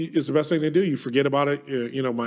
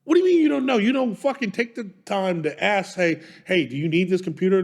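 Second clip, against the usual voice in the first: higher, heated rant that opens with a loud yell; retold angry outburst; 6 semitones higher, 4 dB louder than usual. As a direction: rant at her like it's happening again, starting at a yell. Retold blow-up at wife.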